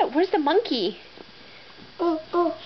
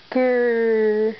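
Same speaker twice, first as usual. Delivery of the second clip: bear growl; 7 semitones lower than usual. Pretend. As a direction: lower playful bear growl. Mom reading animal book to toddler.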